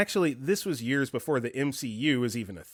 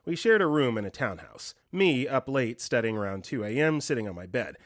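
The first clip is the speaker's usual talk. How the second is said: ordinary talk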